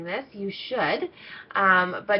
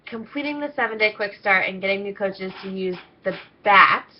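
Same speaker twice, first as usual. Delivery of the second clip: usual speaking voice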